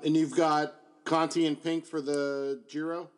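ordinary talk

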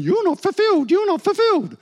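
high, loud needling voice of doubt; nagging inner voice. Retold or imitated. Preacher voices the part of him that feels unfulfilled, high and insistent. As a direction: let the restless inner voice nag at him. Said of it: "this other part of me going".

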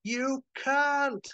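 high, stretched-out screamed words; acted scream. Chet screams at Howard in the rift, screaming. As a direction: scream it at your foe, word by word. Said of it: "Chet screams".

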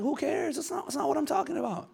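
high, soft, whiny protest; mock exasperation. Sharer answers churchgoer changing the subject, exasperated. Acts exasperated reply to religious talk.

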